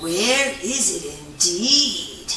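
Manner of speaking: usual speaking voice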